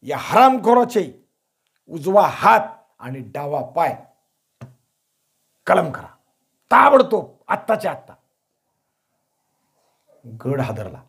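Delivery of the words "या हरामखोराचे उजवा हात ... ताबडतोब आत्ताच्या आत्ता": furious order. A narrator's reading. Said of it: "महाराजांनी हुकूम सोडला".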